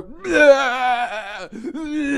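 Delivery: high, loud mock bawling; imitated crying. Imitating the cashier breaking down, exaggerated garbled crying. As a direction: wail long, garbled nonsense sobs. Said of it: "that was exactly what her crying was".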